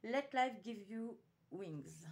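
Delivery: slow, loud French-accented English. Real, not acted. Vlogger announces a pad's English name, slow French-accented English. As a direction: spell out the English title slowly in a French accent. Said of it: "mon accent anglais".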